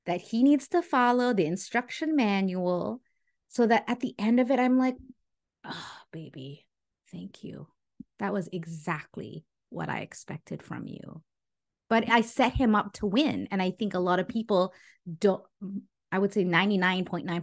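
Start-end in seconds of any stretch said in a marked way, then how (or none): 5.6-11.2 much softer, cooing gratitude; sweet soft thanks. Retold or imitated. Wife softly thanking husband after he followed her formula, cooing. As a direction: melt into a soft, pleased thank-you.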